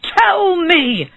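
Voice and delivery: high, drawn-out shout; shouted demand. A narrator's reading. Bird seller demands an answer from the dwarf, shouting. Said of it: "he shouted".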